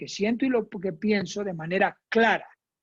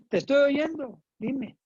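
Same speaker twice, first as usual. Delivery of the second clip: higher, offhand dime trailing off; dismissive reply; 6 semitones higher than usual. Shown as a demonstration. Instructora actúa a alguien que ignora, con desgano. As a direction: brush someone off while staring at the phone. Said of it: "Eso no".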